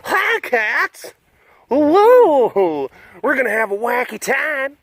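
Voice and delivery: high, loud, hammed-up zoo-visitor glee; mock overexcited greeting. Talking to big cats, the over-the-top visitor she won't be. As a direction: burst in all wacky and over the top. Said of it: "just being like".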